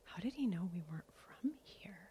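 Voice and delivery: hushed, breathy whisper of a puzzled question; whispered quote. Retold or imitated. Retelling brother's baffled question to the host, whispered. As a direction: whisper it like a secret worry.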